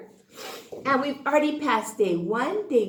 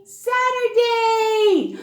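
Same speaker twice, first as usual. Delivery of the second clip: excited cheer; 8 semitones higher, 6 dB louder, slower than usual. Acted. Teacher cheering the weekend day.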